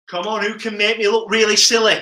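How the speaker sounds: ordinary talk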